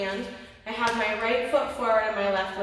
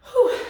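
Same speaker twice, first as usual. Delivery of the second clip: breathless whew; 13 semitones higher, 9 dB louder than usual. Real, not acted. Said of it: "I'm a little out of breath".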